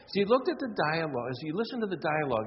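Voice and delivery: ordinary delivery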